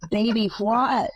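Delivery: slow, high, baffled country mom; a slow, bewildered mother asking back; puzzled mama. Retold or imitated. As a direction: drag it out like a confused mother. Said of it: "Mama goes".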